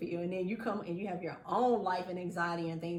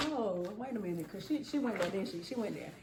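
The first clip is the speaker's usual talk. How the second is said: hushed mock gossip; 7 dB quieter than usual. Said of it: "And you're gonna be like".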